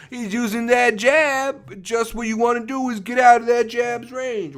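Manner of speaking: corner man advice. Retold or imitated. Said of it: "A corner man is usually the guy who's like".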